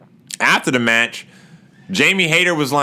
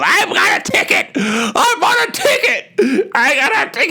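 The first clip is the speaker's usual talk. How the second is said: very high, whining fake wail; hammed-up tearful protest, pitched way up; mock crying. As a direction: wail it like an aggrieved fan. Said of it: "He's crying 'cause he bought a ticket".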